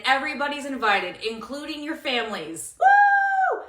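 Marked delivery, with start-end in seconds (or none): 2.8-3.6 high, loud party whoop; cheer. Real, not acted.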